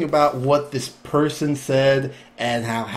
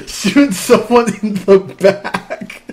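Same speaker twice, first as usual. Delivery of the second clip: "back" corrected while laughing, slow; "shooting someone in the back" laughed out slowly; laughing correction; 8 semitones higher, 8 dB louder, slower than usual. Real, not acted.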